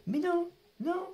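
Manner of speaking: high-pitched real disbelief. Real, not acted. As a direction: squeal in shocked denial. Disbelief at card result.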